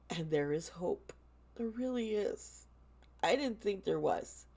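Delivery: holding back real tears while reassuring viewers; tearing up. Real, not acted. Vlogger telling struggling viewers they are loved, tearing up. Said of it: "I'm tearing up".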